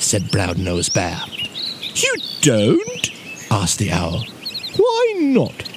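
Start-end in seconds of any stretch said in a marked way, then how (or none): 1.9-3.1 high, questioning owl voice; high-pitched owlish query; surprised owl. A narrator's reading. Owl taken aback by flattery. 4.8-5.6 high, owlish question; high-pitched puzzled owl. A narrator's reading.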